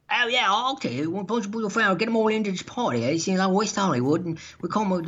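high, chattering British-or-Australian caricature; mock British-Australian accent. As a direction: do a breezy British or Australian promoter. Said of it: "a British guy or Australian guy".

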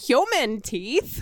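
very slow, drawn-out question. Pretend. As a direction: stretch it out slowly.

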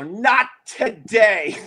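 high, very slow, drawn-out refusal; slow defiant cry breaking into laughter; mock action-hero defiance. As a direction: draw out each word like a hero. Tackles the charging dog.

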